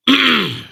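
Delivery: sharp throat clear; loud throat clearing. Real, not acted. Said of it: "clear my throat here".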